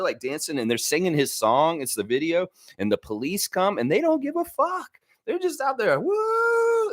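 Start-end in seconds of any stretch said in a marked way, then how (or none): 3.8-6.9 high, wild party whoop; partying cheer. Retold or imitated. Women partying after the blast, high cheering.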